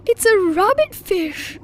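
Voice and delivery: excited. A narrator's reading. Daddy Pig excited at odd fish.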